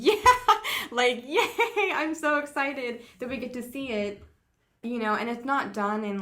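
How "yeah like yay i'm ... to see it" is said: high, loud gushing yay; excited cheer. Retold or imitated. Fan cheering at the movie, high and loud. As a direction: squeal with delight at the screen. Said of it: "and I'm just like".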